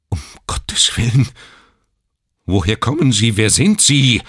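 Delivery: a shocked, desperate father; desperate outburst. A narrator's reading. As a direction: blurt it out in despair. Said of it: "mit verzweifelter Stimme".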